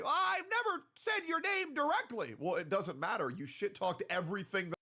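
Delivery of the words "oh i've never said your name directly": mocking quote. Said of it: "these other motherfuckers say".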